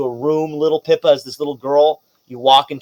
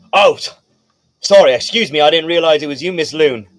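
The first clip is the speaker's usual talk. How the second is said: loud, higher, flustered guard voice; apologetic guard; 4 semitones higher, 6 dB louder than usual. Acted. Guard realizing the child is Miss Loon, loudly apologetic. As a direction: backtrack hastily as a doorman.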